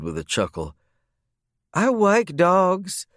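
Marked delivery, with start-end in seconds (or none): 1.7-3.1 put-on high, slow, sing-song little girl; cute little drawl. Bailey defending dogs to her uncle, sung in a high little drawl. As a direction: stretch it out sweetly like a small child. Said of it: "Bailey sang in her cute little drawl".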